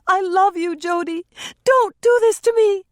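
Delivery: high, loud panicked scream; shrieking No in panic; panic-stricken. Acted. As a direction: scream to stop him. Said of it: "sounded positively panic-stricken".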